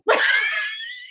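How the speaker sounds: very high squeal of laughter. Shown as a demonstration. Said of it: "they look at their friend".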